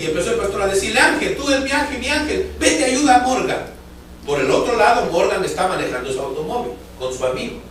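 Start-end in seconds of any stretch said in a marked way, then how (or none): 1.0-3.7 much higher, urgent command; calling out. Retold or imitated. Pastor sending his angel to Morgan, high urgent call. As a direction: send him off with a raised cry.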